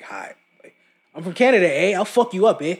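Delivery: lightly hammed-up Aussie-style Canadian accent; half-hearted Australian accent for a Canadian; mock Canadian accent. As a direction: play a cocky Canadian with an Australian twang. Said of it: "I gave Canadians Australian accents".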